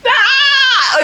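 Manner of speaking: high wail to shake off tears; frustrated tearful wail. Real, not acted. Woman trying not to cry, high frustrated wail. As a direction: let out one high laughing cry.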